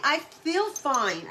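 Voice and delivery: ordinary talk, nothing marked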